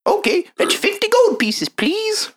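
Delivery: high, put-on gnome shopkeeper; squeaky-high gnome merchant.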